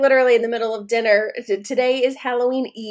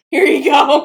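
mock offering; 4 semitones higher, 10 dB louder than usual. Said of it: "I'm like".

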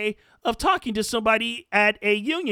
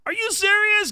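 1 incredulous; 8 semitones higher, 3 dB louder than usual. Real, not acted. Reacts to vaccine clip.

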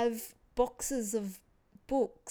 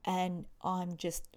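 ordinary talk, nothing marked

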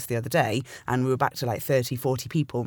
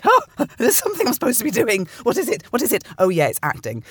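sudden realisation; 10 semitones higher, 5 dB louder than usual. Retold or imitated. Retold sudden realisation at forty.

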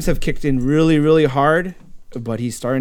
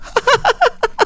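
high mechanical mock laughter; staccato sitcom giggle; imitated laugh track. Laughing from the old sitcom, high imitated laugh track. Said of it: "All the laughing coming from the show".

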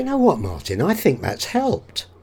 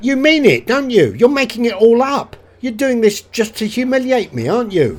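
loud, raised-pitch protest; stung, pushing back hard; indignant accusation; 3 semitones higher, 5 dB louder than usual. Acted. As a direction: drop the act and accuse him. Hurt actor accuses his partner.

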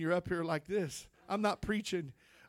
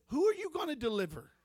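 a touch of a scornful father; mock the son's preaching like a doubting dad; lightly voiced scolding father; 5 semitones higher, 4 dB louder than usual. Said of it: "He said".

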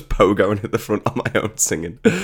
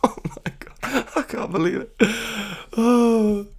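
laughing; 13 semitones higher than usual. Real, not acted. Laughing in disbelief.

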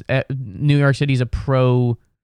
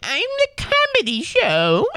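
much higher, airy mock of a smug girl; mocking snobby girl; slower than usual. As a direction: lampoon the girl who thinks she's funnier. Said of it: "they were like".

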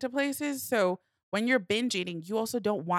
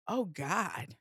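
softer, dismayed realization; sincere disgust; 6 dB quieter than usual. Retold or imitated. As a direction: let it drop quietly in disgust. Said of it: "in that moment of, like, sincere disgust".

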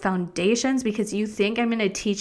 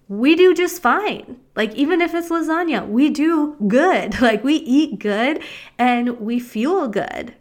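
emphatic; 4 semitones higher, 4 dB louder than usual. Real, not acted. Emphatic boast about eating well.

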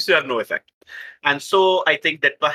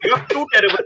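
laughing scolding; 7 semitones higher than usual. Acted. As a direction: try to scold but keep cracking up. Scolding in character while laughing.

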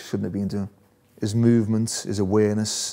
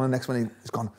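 ordinary talk

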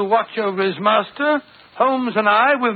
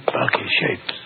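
whisper. Acted. Watson asking about the shapes, whisper. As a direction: breathe the question. Creeping into dark vault.

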